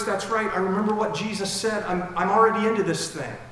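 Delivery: urgent realization. Acted. Peter warning himself at the rooster, loud and urgent. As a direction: snap awake, urgent and loud. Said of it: "first time he heard the cock crow".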